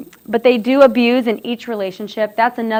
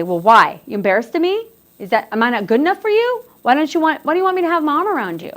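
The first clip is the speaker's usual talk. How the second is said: rising hurt accusation, higher; imitating a jealous partner; 7 semitones higher, faster than usual. Presenter voices a jealous partner, hurt and accusing. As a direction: push it up into wounded complaint. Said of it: "The partner would say".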